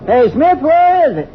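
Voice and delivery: calling out. Acted. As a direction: call out to someone far below. Calling to the ranger below.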